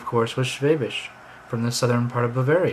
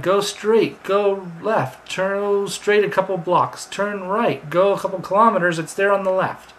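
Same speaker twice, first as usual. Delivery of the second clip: higher, louder list of directions; pitched-up list of directions; translated directions; 6 semitones higher, 4 dB louder than usual. Pretend. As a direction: tick off each turn briskly. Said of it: "But you didn't understand".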